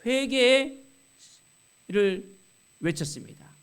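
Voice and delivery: loud, high-pitched call; crying out. Retold or imitated. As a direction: call it out like a prophet. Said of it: "뭘 외쳤습니까?".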